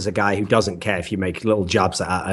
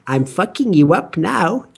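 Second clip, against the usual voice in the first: high, slow villain gloat with giggles; gloating evil; 9 semitones higher, slower than usual. Pretend. Imagining Anders turned evil diva, mock-evil gloating. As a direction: play a friendly guy gone evil, cackling. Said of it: "I wanna see that smile be evil".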